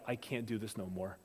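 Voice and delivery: low, soft admission of giving up; quietly acted weary surrender; mock defeat. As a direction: drop the voice, spent and beaten. Acts out worn-out hunter giving up.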